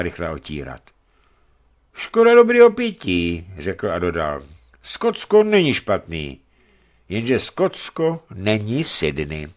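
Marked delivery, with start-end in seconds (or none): 1.9-3.5 high, loud mock lament; drunk sailor lament. Spilled beer lament. 4.8-9.5 slow tipsy musing. Acted. Tipsy sailor comparing homes.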